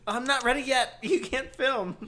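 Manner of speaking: high, loud diva protest. Retold or imitated. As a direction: play the diva hiding backstage. Voicing a queen.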